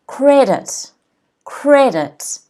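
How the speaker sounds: Credit, CRE punched and falling; stress on CRE. Shown as a demonstration. Teacher repeats Credit for learners, stressing CRE. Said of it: "the first syllable is stressed".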